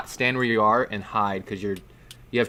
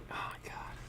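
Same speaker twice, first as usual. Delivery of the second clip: barely voiced, under the breath; stunned whisper; 20 dB quieter than usual. Real, not acted. Game master stunned by the dice just rolled, whispering. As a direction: whisper it to yourself in disbelief.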